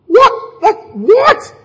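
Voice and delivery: high, startled "what"; high, incredulous double take; baffled. Retold or imitated. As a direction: react in disbelief. Disciples baffled at Jesus.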